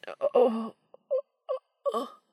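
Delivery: squeaky high strangled oh; strangled cry. A narrator's reading. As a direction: choke it off high. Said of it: "a strangled cry".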